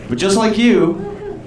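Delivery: ordinary delivery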